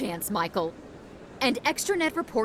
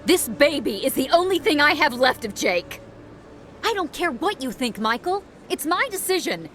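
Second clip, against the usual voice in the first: raised, higher, impassioned voice; heated defiance; 5 semitones higher, 4 dB louder than usual. Acted. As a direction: stand your ground in a heated quarrel. Widow quarrels with brother-in-law.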